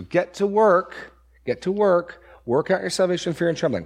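high, loud push to get to work; urgent exhortation. Real, not acted. Preacher urging the congregation to work out salvation, loud and high.

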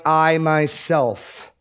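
I myself drawn out very slowly, weight on I; emphatic I myself. Shown as a demonstration. Preacher stresses God's own promise, slow and emphatic. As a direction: lean hard and slow on I myself. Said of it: "notice that emphasis".

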